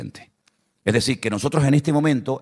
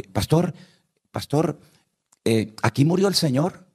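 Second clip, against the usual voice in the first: high, eager little churchgoer voice; odd sister asking; 7 semitones higher than usual. Retold or imitated. Pastor mimics the odd sister in his group, high and eager. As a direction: pipe up like an over-keen old sister.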